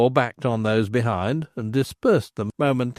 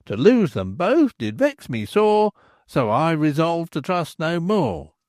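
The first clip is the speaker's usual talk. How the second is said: lightly raised, sing-song reading of a tavern sign; rhymed notice read aloud; 5 semitones higher than usual. A narrator's reading. Narrator reading the landlord's notice in a sing-song way. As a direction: recite the verse like a posted notice.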